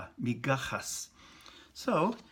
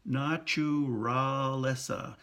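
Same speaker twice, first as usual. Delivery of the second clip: naturaleza slowly with a tapped r; slow syllables; much slower than usual. Shown as a demonstration. Teacher drawing out naturaleza, slow and clear. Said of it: "So ra is not ra".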